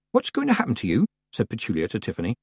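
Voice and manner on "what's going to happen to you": put-on high, concerned girl; timid girl voice. A narrator's reading. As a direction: ask her gently. Petulia voiced, hesitant.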